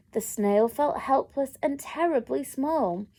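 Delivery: ordinary delivery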